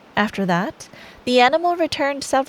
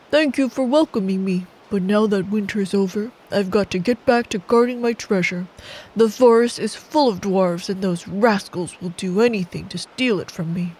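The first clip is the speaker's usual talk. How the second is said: bear voice; 2 semitones lower than usual. Acted. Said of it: "The bear replied".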